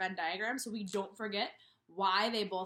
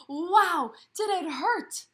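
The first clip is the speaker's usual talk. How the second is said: louder and higher, acted storybook cry; loud exclamation; 8 semitones higher, 12 dB louder, much slower than usual. Reading the bee sting to the class, loud higher cry. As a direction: burst out with the sting.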